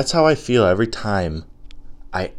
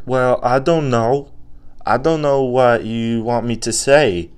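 put-on little kid. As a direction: answer like an unsure little child. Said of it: "and the little kid's like".

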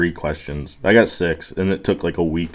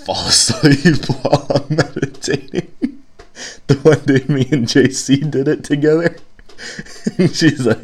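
laughing through the words; 8 semitones higher, 4 dB louder than usual. Real, not acted. Podcaster laughing about dozing off meditating with J C. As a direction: giggle as you confess dozing off.

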